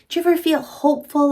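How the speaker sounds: high, fast, hopeful question; eager question. Acted. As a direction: ask it brightly and quickly. Reading hopeful page.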